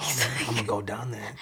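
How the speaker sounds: soft imitation of a tempted toddler; hushed awe. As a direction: murmur it as a kid plotting. Toddler eyeing the stairs.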